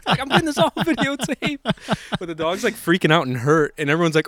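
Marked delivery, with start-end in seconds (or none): none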